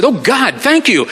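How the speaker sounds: high-pitched, delighted thanks; exclaimed gratitude. Retold or imitated. Employee thanking threatening boss for the sign, elated. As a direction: burst out as if a prayer is answered. Retold relief at getting his sign.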